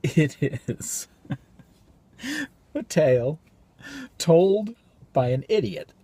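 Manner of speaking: loud, high, broken by long pauses; manic soliloquy. Acted. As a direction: declaim it on the edge of cracking up. Soliloquy turns to manic outburst.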